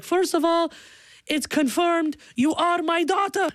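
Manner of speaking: high-pitched overjoyed declaration; so happy. Retold or imitated. Dad celebrating his daughter's election win, high and happy. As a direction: imitate a delighted, proud dad. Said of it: "so happy".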